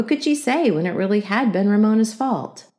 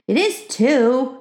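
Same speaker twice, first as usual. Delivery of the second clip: put-on slow, high, defiant child; defiant little girl's comeback; 7 semitones higher, 3 dB louder, much slower than usual. A narrator's reading. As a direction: insist stubbornly, drawn out. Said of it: "said Ramona defiantly".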